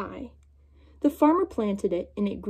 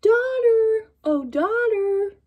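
high, louder sing-song call; wife calling out; 10 semitones higher, 4 dB louder, much slower than usual. Acted. Wife calling her daughter to help, high lilting call. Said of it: "the wife called her daughter".

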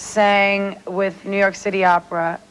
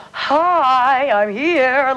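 a hammed-up grand entrance, a bit louder and higher; imitating diva mother; 4 semitones higher, 3 dB louder, much slower than usual. [name] mimicking her diva mother's grand arrival. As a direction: announce yourself like an opera diva making an entrance. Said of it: "She'd be like".

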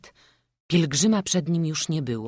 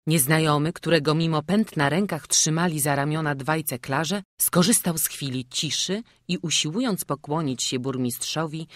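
ordinary delivery

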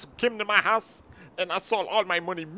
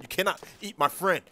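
usual speaking voice

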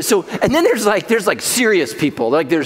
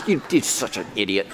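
low, quiet put-down, lightly voiced; mock insult; 9 semitones lower, 6 dB quieter than usual. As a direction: sneer it like a bathroom-wall scribbler. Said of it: "set this person".

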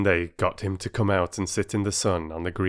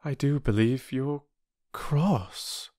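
lightly voiced anxious child, higher pitch; a touch of hesitant worry; hint of a concerned child; worried child; 7 semitones higher, slower than usual. A narrator's reading.

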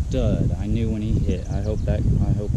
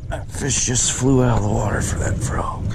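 whispered; 3 semitones higher than usual. Real, not acted. Angler telling viewers about the strike, whispered. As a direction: hush it like a secret to the camera.

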